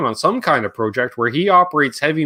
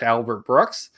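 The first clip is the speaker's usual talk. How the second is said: normal talking voice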